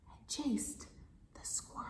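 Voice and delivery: dramatic storytime whisper. Acted. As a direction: hush it to a secret whisper.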